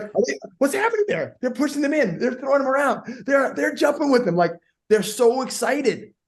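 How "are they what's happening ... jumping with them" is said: high, startled disbelief; mock alarmed onlooker. Newcomer who doesn't know krumping watches, mock alarm. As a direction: play a bewildered bystander, pitched way up. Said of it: "you'd be afraid".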